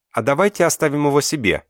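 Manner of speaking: put-on high, loud child's blurt. Little Lera asks to keep the baby, loud and high. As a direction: blurt it out loudly like an eager little girl. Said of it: "выдала громко Лера".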